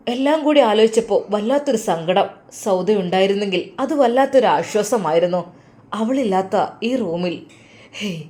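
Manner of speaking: usual speaking voice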